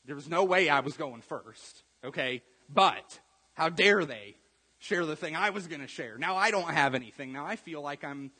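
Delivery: indignant complaint. Retold or imitated. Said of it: "And you're like".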